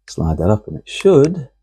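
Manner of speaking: smiling, hopeful tone; hopeful smile. Real, not acted. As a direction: say it hoping it works, smiling. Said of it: "hopeful smile in his voice".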